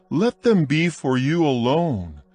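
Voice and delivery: ordinary delivery, nothing marked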